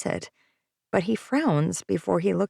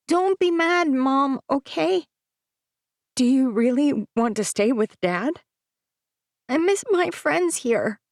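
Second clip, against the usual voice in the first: put-on high, pleading little boy; young boy voice; 8 semitones higher, 3 dB louder than usual. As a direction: plead like a worried child. Child son voiced in story.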